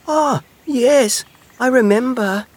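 high, sighing little hedgehog; fond sigh. A narrator's reading. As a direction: sigh over a fond memory. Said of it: "sighed Little Hedgehog".

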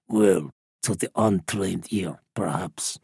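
put-on low, soft, knowing old man; old smuggler. A narrator's reading. Old smuggler correcting Lisa about the bird, low and quiet. Smuggler boasting of his ear.

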